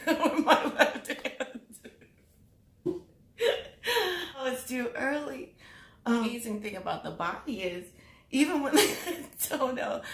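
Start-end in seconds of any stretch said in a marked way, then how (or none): 0.1-4.4 slow, high words dissolving into real giggles; laughing at own joke. Real, not acted. Vlogger muddling hand and foot, cracking herself up. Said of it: "I laugh at my own jokes". 8.3-10.0 high-pitched words broken by a giggle; laughing at own joke. Real, not acted. Vlogger telling how the toenail came off, giggling. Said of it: "I laugh at my own jokes".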